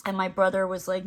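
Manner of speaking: ordinary delivery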